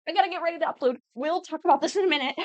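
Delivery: high, quick self-quote; playfully frantic postponing; acted hurried brush-off. Quotes herself cutting off a discussion.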